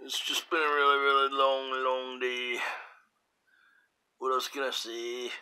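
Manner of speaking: goofy voice. Pretend. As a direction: stretch out the long day in a silly voice. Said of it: "if somebody did talk like that".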